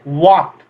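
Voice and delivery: "वॉक" said alone with a silent L; correct pronunciation. Shown as a demonstration. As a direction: model "वॉक" clearly, no L before the K. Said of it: "इसको पढ़ते हैं वॉक". ("वॉक" में ल को चुप रखकर)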